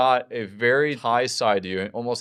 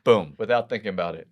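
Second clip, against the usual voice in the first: normal talking voice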